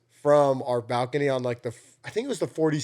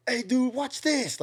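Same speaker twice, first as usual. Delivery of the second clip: high, slow mock "watch this" dare; mock daredevil; 10 semitones higher, 4 dB quieter, slower than usual. To his friends, a high slow mock dare. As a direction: egg them on like a reckless drunk.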